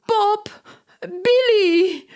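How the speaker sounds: high, crying call for her fleas; near-tearful call; desperate calling. A narrator's reading. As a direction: wail their names. Lola calls lost fleas.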